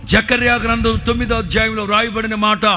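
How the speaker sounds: loud, high-pitched fervent proclamation; preaching at full force. Real, not acted. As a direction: declare the blessing over the crowd with full power.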